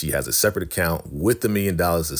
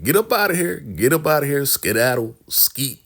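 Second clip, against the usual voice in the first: high, hammed-up get-lost order; imitated dismissive chasing-away; mock shooing; 8 semitones higher, 3 dB louder than usual. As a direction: shoo them off in a raised voice. [name] shoos Elder Mother away, acted quarrel.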